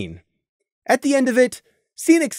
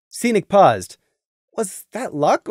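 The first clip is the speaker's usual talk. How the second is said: ordinary delivery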